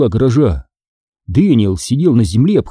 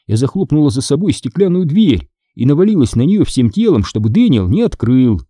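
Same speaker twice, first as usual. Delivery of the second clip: ordinary delivery, nothing marked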